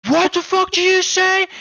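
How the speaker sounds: high, loud mock yell of an angry buddy; high-pitched yelled challenge; imitated furious yell. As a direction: shout it way up high like the furious friend. Buddy yelling at the other guy.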